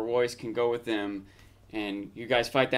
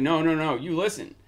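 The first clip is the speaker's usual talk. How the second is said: higher, insistent cut-in; firm retort, acted; 5 semitones higher, 5 dB louder than usual. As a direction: cut him off firmly, mildly acted. Acting queen's firm retort to Jon.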